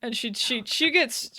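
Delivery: usual speaking voice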